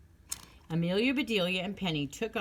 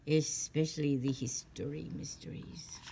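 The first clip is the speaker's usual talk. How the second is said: whispered. Acted. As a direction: let the secret out in a hushed whisper. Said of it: "a whispery voice with a French accent".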